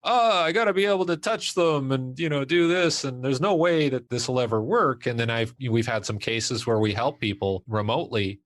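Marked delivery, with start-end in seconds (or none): 0.1-5.1 higher-pitched mock protest; resistant friends. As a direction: imitate friends balking at the idea. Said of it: "were a little more resistant".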